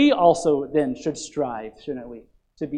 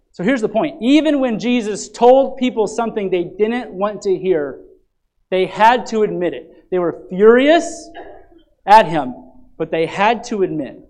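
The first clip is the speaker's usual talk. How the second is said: loud, rising sermon delivery, pressing each line home; full-force preaching; 5 semitones higher, 8 dB louder than usual. Acted. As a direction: proclaim it to the congregation.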